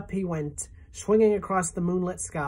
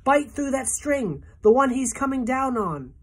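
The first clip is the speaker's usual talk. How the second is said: higher, louder urgent order; urgent yell; 8 semitones higher, 5 dB louder than usual. A narrator's reading. James urges the centipede to bite the string, loud. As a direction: hurry him with a shouted command. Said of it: "yelled James".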